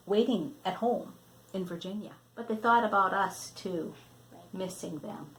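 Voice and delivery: reading a picture book to a grandchild; storybook reading. Acted. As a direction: read it out gently, like a bedtime story. Grandmother reads picture book aloud.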